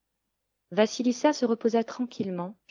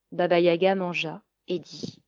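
ordinary delivery, nothing marked

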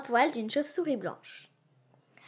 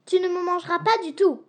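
dry, sharp reply, louder; sèchement; curt retort; 5 semitones higher, 6 dB louder than usual. Acted. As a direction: snap back firmly. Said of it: "répliqua sèchement Leslie".

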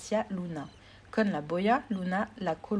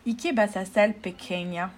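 loud sneering villain voice; mustache twirling villain; 5 dB louder than usual. Acted. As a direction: taunt her like a cartoon baddie. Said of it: "con una sonrisa malvada".

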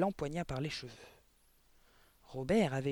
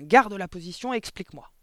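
loud, raised barking order; barked; 4 semitones higher, 10 dB louder than usual. A narrator's reading. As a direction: bark a command at a pupil. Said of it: "aboya mademoiselle Le Gourdin". (ordre aboyé)

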